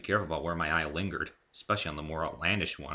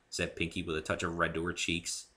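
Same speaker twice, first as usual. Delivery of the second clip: ordinary delivery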